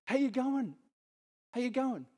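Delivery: high, bright mock greeting; cheery greeting. Preacher acting out greeting a friend, high and cheery. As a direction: sing it out like a friendly hello.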